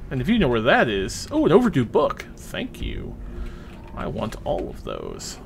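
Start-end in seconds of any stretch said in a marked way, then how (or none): none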